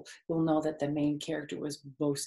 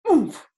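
loud higher landing grunt; 5 semitones higher, 10 dB louder than usual. Acted. Wilbur thudding down, loud grunt. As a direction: oomph as he hits the ground. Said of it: "he grunted".

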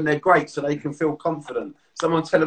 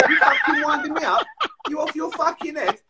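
higher, laughing through a mock telling-off; laughing mock outrage; 12 semitones higher than usual. Playing his mate telling him off in the joke, high and laughing. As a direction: crack up while pretending to be offended.

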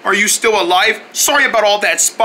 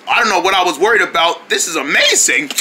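normal talking voice

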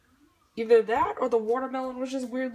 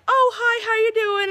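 mock kid greeting; 13 semitones higher, 11 dB louder than usual. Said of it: "they're like".

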